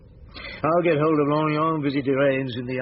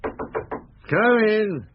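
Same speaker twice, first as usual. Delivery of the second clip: calling out; 9 semitones higher than usual. Acted. Calling through a closed door.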